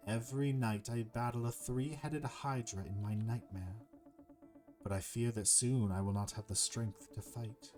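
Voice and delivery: measured, formal serpent voice; troubled serpent. Acted. Cursed serpent confides his nightmares to the hero, solemn and measured. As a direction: recount the curse with solemn deliberation.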